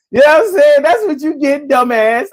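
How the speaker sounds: high, teasing jab; mock-squeal the insult; mocking taunt. Real, not acted. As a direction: rub it in with a squeaky sneer.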